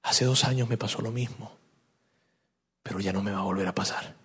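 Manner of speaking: low, soft musing; quiet inner thought. Acted. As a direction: murmur it privately, with resolve. Pedro's private thought, voiced. (pensamiento en voz baja y grave)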